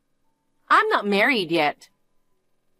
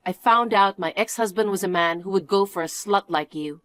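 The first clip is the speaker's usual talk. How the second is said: usual speaking voice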